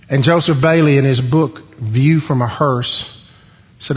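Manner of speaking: ordinary talk, nothing marked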